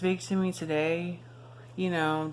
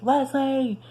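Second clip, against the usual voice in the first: sharp raised call of her name; 8 semitones higher, 5 dB louder than usual. Retold or imitated. Stalker calling her name in the cafeteria, loud call.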